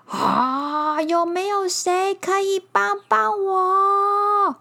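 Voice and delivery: high, slow desperate plea; pleading bear. Acted. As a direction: beg for help.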